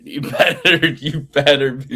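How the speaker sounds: playful threat breaking into laughter; laughing warning. Real, not acted. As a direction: warn a friend while cracking up. Laughing while warning co-host about birthday.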